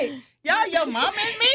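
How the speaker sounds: put-on mother baffled by her kids' yo mama jokes, laughing; mock surprise. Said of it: "I was like".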